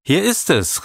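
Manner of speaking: put-on high, breathless child's joy; triumphant return with the telescope; happy cry. A narrator's reading. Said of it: "rief sie glücklich".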